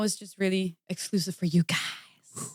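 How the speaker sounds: playful secret whisper. Real, not acted. Confiding exclusive news to fans.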